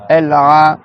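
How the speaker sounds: El A with the A tightened mid-throat; squeezed ayn. Shown as a demonstration. Teacher showing students El A, throat squeezed. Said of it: "faut que tu serres ici".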